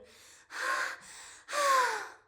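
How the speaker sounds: high, loud breathy gasps of pleasure; hammed-up sensual moan; acted loud moan. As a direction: let out two big mock-ecstatic sighs. Acts out being pushed against wall.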